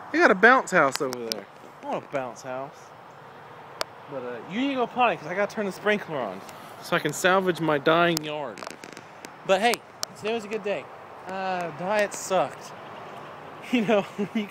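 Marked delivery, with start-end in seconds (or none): none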